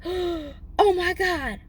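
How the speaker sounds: high, slow stunned gasp of oh my God; reliving the moment the wig vanished; horrified. Retold or imitated. Stunned woman realizing her wig is gone in the river.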